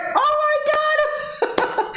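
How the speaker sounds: very high, drawn-out squeal; stretched shriek of amazement into laughter; freaking out. Retold or imitated. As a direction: imitate mom losing it with excitement. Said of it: "So she's freaking out".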